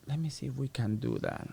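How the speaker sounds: very soft, noncommittal reply; guarded murmur. Retold or imitated. Prosecutor weighing the informant's offer, hushed. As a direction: say it low-key, almost to yourself.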